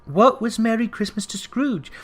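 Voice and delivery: ordinary delivery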